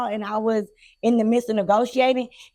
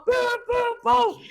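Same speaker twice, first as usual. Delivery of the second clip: high, punched booms imitating a rumble; imitated booming; 11 semitones higher, slower than usual. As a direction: sound out the booms, rising high. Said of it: "I hear this big old, the hum".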